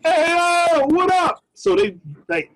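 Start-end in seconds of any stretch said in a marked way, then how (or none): 0.0-1.4 high, loud hey-yo neighbor call; loud friendly greeting. Retold or imitated. Neighbors calling out loud and high to greet him. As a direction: shout a happy hello across the yard. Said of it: "they get loud when they see me".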